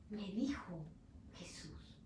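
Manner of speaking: breathy whisper, words barely voiced; whispered recollection. Peter, alone, recalling what Jesus told him, whispered. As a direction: whisper it as if remembering to yourself.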